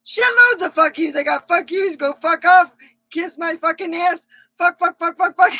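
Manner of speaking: high, loud cackling cussing spree; gleeful rant. Pretend. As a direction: spit out the curses with wicked glee. Mock rant about buying curses.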